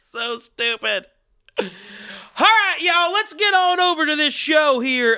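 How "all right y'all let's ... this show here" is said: loud, high Southern call to the audience; rousing show opener. Pretend. Host kicking off the show, loud rallying call. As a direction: holler them into the show, easing off.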